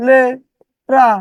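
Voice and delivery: লে and রা as separate punched syllables; distorted spelling pronunciation. Shown as a demonstration. Teacher spelling out the word, syllable by syllable. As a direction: dictate লে... রা with a clear gap. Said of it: "বিকৃত ভাবে উচ্চারণ করবেন".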